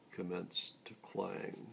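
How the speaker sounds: plain reading voice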